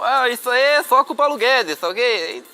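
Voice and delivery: high, loud put-on president voice; Bolsonaro impression. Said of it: "Você é bom imitador também".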